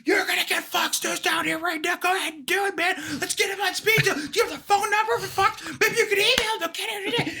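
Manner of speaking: high, shouted taunting dare; mock shouting back. Former Marine judge dares the voter, high mock shout. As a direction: yell the dare right back at him. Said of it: "he's like".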